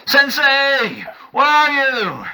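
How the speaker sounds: high, loud desperate scream to the sky. Acted. Warrior screaming for his lost sensei, desperate shout. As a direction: yell it at the top of your lungs. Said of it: "he screams at the top of his lungs".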